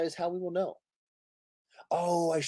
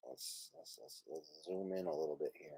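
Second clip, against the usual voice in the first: whispered aside; 11 semitones lower, 22 dB quieter than usual. Real, not acted. Muttering aside while zooming in.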